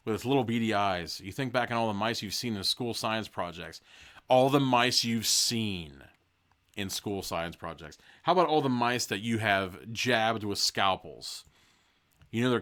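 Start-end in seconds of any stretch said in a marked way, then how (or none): 4.3-8.0 very slow, leaning on each word; slow emphatic repeat. Shown as a demonstration. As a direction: drag out all the mice you've seen.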